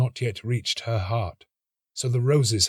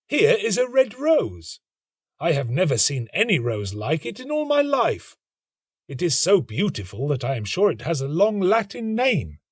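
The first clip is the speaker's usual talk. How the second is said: put-on higher, eager young man's voice; student voice; 6 semitones higher than usual. A narrator's reading. The student admiring the rose, eager higher voice. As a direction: gush over the rose. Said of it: "student admires rose".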